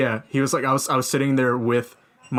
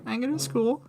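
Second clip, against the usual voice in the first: high, meek self-impression; high timid voice; 13 semitones higher, 4 dB quieter than usual. Imitating himself answering the host, timid and high. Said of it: "I was like".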